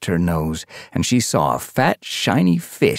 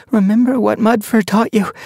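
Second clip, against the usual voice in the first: high, louder she-cat; reminder; 4 dB louder than usual. A narrator's reading. Sun Paw reminds her friend, high voice.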